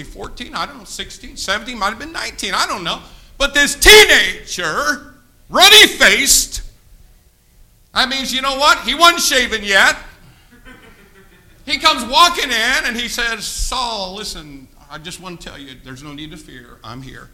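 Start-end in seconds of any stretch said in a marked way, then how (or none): 3.4-6.6 loud and very slow. Real, not acted. Preacher emphasising each phrase. 13.4-17.3 soft, low, reassuring; calm the king down quietly. Retold or imitated. David voiced calmly to Saul.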